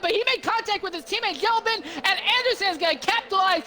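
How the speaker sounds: fast, high excited play-by-play; motorsport commentator. Real, not acted. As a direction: call the race as it unfolds. Excited live race play-by-play.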